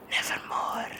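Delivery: breathy final whisper. Acted. Closing the poem with a hushed whisper. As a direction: let the last word die in a whisper.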